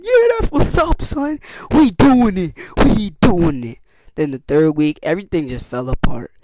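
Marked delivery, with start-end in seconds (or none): 0.0-3.8 much higher, hyped cheer; celebrating. Retold or imitated. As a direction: whoop it up. Team placed eighth, celebrating.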